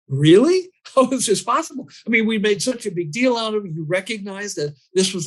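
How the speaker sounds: can't believe he forgot; incredulous. Real, not acted. Incredulous retelling of his forgetting.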